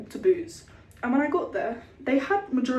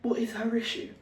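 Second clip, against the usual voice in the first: muttered aside; 6 dB quieter than usual. Retold or imitated. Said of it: "I'd be a bit like".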